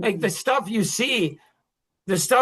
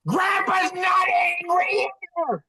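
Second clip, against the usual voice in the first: grandpa insisting; 10 semitones higher, slower than usual. Retold or imitated. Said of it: "I'm like".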